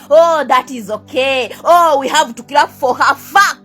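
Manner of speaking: ordinary talk, nothing marked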